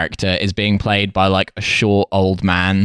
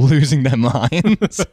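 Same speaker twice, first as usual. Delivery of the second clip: laughing; 8 semitones higher, much slower than usual. Real, not acted. Laughing while describing crowd.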